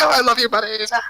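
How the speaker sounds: high, loud cooing to dogs; cooing delight. Acted. Fairy nuzzled by dogs, high loving coo.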